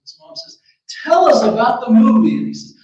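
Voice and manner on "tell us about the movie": lightly voiced mother, louder and higher; mom's loud, high-pitched request; eager mother. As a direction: call out to your son, loud and raised. Mother calls to returning son.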